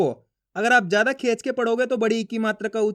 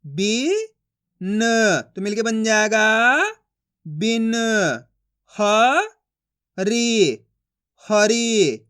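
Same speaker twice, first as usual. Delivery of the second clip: slow, syllable by syllable, each sound separated; teacher sounding out letters for young pupils; phonics drill; 3 dB louder, much slower than usual. Shown as a demonstration. Teacher reads Hindi syllables to class, slow and deliberate.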